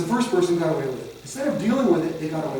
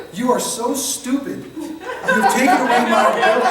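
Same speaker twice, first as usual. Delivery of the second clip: higher, louder, drawn-out mock exasperation; exasperated put-down; 7 semitones higher, 7 dB louder than usual. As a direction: voice the scornful meaning behind a sigh. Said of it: "what he's saying is".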